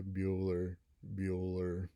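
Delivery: low droning monotone roll call; flat, lifeless Bueller roll call; Ben Stein monotone. Retold or imitated. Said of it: "really monotone, droning voice".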